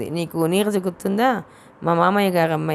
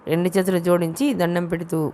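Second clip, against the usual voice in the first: plain reading voice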